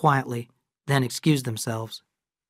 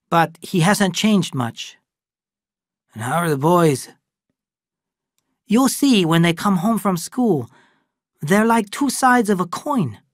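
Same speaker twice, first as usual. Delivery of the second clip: lightly raised voice of Lee, calm and knowing; Lee character voice; 5 semitones higher, 3 dB louder than usual. Acted. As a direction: Lee speaking matter-of-factly to the old friend. Lee describes the twins.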